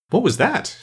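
high-pitched, eager inquiry; curious question. A narrator's reading. Alice inquires about the regular course, curious. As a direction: ask it high and wondering.

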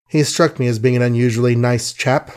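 ordinary delivery, nothing marked

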